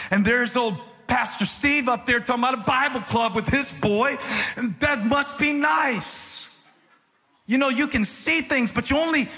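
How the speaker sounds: high, impassioned preacher voice, slowing on key words; preaching at full force. Acted. Pastor preaching to the church about unfulfilled promises, fervently. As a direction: preach it with rising fervour.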